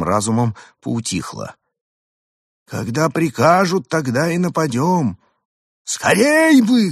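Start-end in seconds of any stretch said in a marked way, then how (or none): 2.7-5.2 lightly voiced alien. A narrator's reading. Arthur's alien voice. 5.8-6.9 high, slow eager alien; bloodthirsty alien. A narrator's reading. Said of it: "кровожадно пожелал Евгений".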